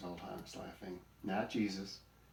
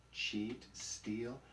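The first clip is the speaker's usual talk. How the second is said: ordinary delivery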